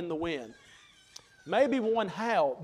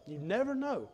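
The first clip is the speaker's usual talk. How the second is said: much softer, trailing "you never know"; softer repeat; 5 dB quieter than usual. Shown as a demonstration. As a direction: let "you never know" fade into a hush. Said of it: "and you never know".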